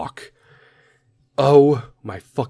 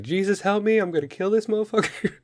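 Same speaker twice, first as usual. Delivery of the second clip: exasperated mom prayer; 10 semitones higher than usual. Retold or imitated. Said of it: "women ... reach up to the Lord".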